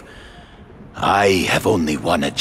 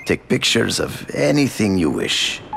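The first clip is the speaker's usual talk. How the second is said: ordinary delivery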